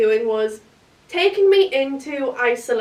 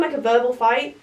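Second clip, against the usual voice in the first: ordinary talk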